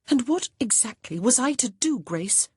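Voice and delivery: a touch of angry frustration. A narrator's reading. Honor answering her sister back, mildly angry. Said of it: "angry with herself".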